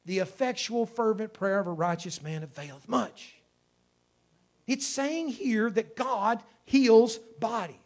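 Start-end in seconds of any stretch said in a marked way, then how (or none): 0.0-3.4 scripture reading. Acted. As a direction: read the verse like a preacher. Reads scripture aloud.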